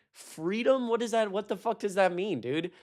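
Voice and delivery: high, disbelieving questions; baffled, mocking the word back; incredulous. Real, not acted. Streamer ranting at the clip, high incredulous questions.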